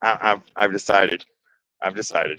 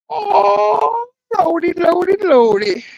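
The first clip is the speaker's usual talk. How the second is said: high, loud half-crying "lordy, lordy"; nervous wailing; 5 dB louder, slower than usual. Real, not acted. Painter frets aloud to chat mid-stroke, high and crying. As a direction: wail it out in high panic. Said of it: "Yes, I'm making noise".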